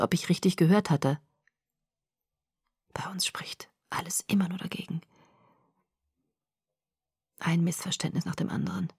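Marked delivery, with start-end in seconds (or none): 2.9-8.9 soft unvoiced whisper, weary; resigned whisper. Edward confessing to Bella, whispered. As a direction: breathe the words out, defeated.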